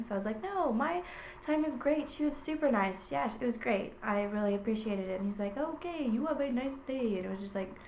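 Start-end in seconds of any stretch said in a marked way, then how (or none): 0.4-5.2 polite reassurance. Retold or imitated. Caller praising support agent to supervisor, politely reassuring. 5.6-7.2 higher, slower mock Indian accent. Imitating a support supervisor signing off in an Indian accent. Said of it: "he was also from India".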